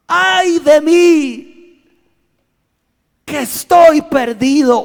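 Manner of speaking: lamento alto, fuerte y lento; grito de angustia. Acted. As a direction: clama aterrado, estirando. (Isaías grita con terror ante Dios)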